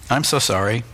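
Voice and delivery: ordinary delivery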